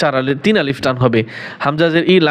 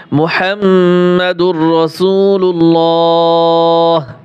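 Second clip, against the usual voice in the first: slow Quranic recitation; 4 dB louder, much slower than usual. Shown as a demonstration. Teacher chants kalima.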